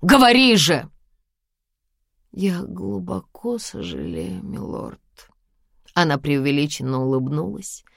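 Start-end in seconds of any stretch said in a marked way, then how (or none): 0.0-0.9 loud high command; harsh barking commands. Acted. Husband demands apology. 2.3-5.0 soft, slow, fading to a whisper; quiet mock apology. Bride's forced exaggerated apology.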